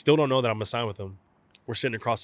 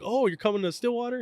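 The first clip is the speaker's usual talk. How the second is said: surprised coach; 14 semitones higher than usual. Retold or imitated.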